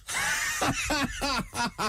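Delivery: very high squeal of laughter; shrieking laugh. Real, not acted. Comedian cracking up at his friend's detective work, shrieking laugh. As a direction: lose it completely.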